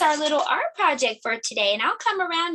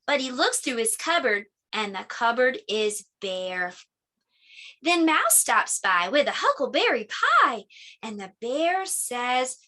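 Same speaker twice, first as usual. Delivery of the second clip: put-on reading to children; 2 semitones lower than usual. A narrator's reading. Teacher reading Bear Says Thanks to the class. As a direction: read it gently to little listeners. Reads picture book to children.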